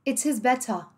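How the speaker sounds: "It is better" with crisp Ts, no final R; British T. Shown as a demonstration. Teacher echoing the British example, crisp T in "better". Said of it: "em inglês britânico".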